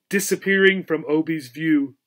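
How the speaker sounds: ordinary delivery, nothing marked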